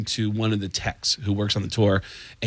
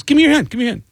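high, louder bossy imitation; pitched-up, pushy retold order; mock insistent; 13 semitones higher, 6 dB louder, much faster than usual. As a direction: imitate a wife insisting on obedience. Wife voiced insisting, repeating her order.

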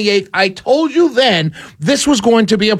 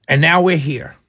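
ordinary talk, nothing marked

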